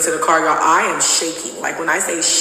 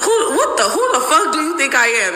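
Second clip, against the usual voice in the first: put-on outraged boyfriend; 9 semitones higher than usual. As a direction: squeal it like he's offended.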